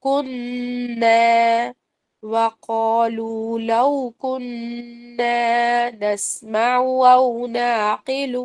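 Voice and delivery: slow melodic tajweed chant; measured Quranic recitation. Shown as a demonstration. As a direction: recite it slowly, stretching the vowels.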